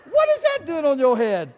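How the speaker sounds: big hammed-up gasp of surprise; overplayed astonishment, loud and high; mock shock. Mock shock at plunger hat.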